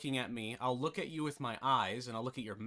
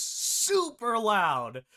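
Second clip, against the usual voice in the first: "super loud" drawn out high and loud; stress on SUPER LOUD; 9 semitones higher, 8 dB louder, much slower than usual. Real, not acted. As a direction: stretch and belt "super loud".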